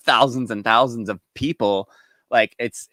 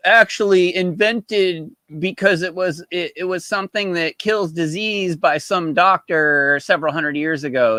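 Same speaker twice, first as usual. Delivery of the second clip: mocking the video guy; 6 semitones higher, 5 dB louder than usual. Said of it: "he's all".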